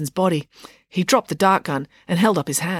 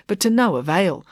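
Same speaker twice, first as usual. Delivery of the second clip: ordinary delivery, nothing marked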